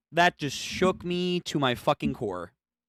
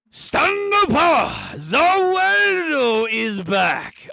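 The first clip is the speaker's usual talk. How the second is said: slow, loud hype call, higher; hyped shout; 6 semitones higher, 4 dB louder, slower than usual. Real, not acted. Fan hyping the villain's return to stream, loud slow shout. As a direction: announce it like a triumphant comeback.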